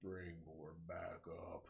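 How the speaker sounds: slow, soft zombie imitation. Zombie tricking the police dispatcher over the car radio, mock zombie voice. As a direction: draw it out slowly like a zombie posing as police. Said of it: "the zombies went to the car and was like".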